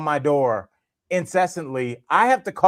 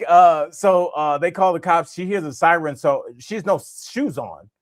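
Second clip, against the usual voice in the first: usual speaking voice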